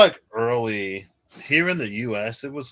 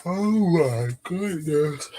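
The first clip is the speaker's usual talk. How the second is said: slow words stretched through a yawn; drawn-out yawny exclamation; 7 semitones higher, 4 dB louder, much slower than usual. Real, not acted. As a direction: talk through a real yawn. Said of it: "Excuse me".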